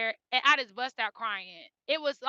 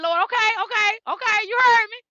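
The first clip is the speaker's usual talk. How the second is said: retold tearful thanks to the Lord, higher and louder; crying prayer; 9 semitones higher, 7 dB louder than usual. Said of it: "So I was just crying".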